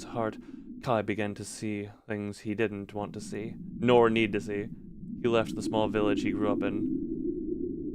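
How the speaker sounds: low, even storyteller read; story narration. Acted. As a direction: read it like a dark fairy tale.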